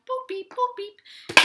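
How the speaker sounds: very high boop-beep robot noises. Acted. Storyteller plays the robot in the song, high beeping. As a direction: squeak out robot boops and beeps.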